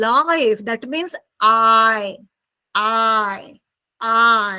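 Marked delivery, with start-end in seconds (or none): 0.1-0.6 life with a full long I; correct pronunciation. Shown as a demonstration. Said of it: "That will be". 1.9-4.5 long, falling I glide; I sound held and modelled singly; isolated I sound. Shown as a demonstration. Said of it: "This is I sound".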